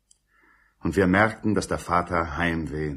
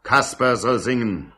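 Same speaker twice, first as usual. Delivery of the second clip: shouting crowd; 5 semitones higher, 5 dB louder than usual. A narrator's reading. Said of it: "Man schrie".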